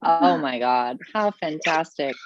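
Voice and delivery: ordinary talk, nothing marked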